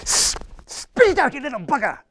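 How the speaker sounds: high, put-on voice scolding the fly; a drinker berates a fly in his pint, high and comic; angry Irishman. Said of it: "Irishman picks out the fly too and goes".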